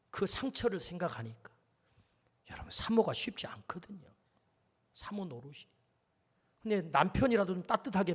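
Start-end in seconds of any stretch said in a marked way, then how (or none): 2.5-5.7 confiding whisper. Real, not acted. Confiding aside to the congregation.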